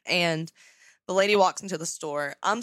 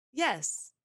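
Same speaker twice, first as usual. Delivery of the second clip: a pointed, higher "Yes," with a slightly put-on voice; that exact tone; 6 semitones higher than usual. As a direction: say "Yes," flat and pointed, like the question was dumb. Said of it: "in that exact tone".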